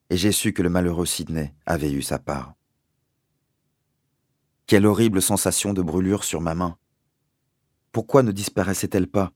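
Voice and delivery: usual speaking voice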